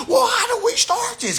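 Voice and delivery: very high, loud whiny cry; mock clueless student. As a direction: shout it like a helpless beginner. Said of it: "somebody else say".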